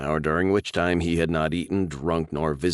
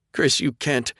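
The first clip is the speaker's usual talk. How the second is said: pleading; 9 semitones higher than usual. Acted. Sarah pleads.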